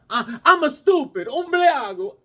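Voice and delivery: a hint of a New York Italian accent; mock Italian accent. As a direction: play a Little Italy local, lightly. Put-on Italian voice.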